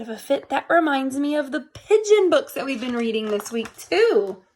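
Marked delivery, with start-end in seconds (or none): none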